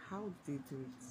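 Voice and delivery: hushed eerie question; hushed creepy legend telling. Real, not acted. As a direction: drop to a near whisper, spooked. Eerie rhetorical question, very soft.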